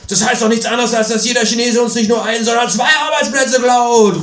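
high, loud indignant shouting; outraged shout. Acted. Freundlich outraged at the Chinese, high loud indignant yell. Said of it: "ruft er empört".

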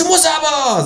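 high, insistent animal voice; insistent kangaroo. Acted. Kangaroo insists while swinging at the narrator, high. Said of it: "schlägt dabei wild".

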